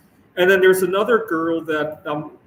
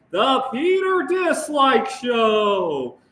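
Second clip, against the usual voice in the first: show intro shout; 8 semitones higher, much slower than usual. Pretend. Host announcing his own show, slow booming shout. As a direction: belt out the show title like a hype announcer.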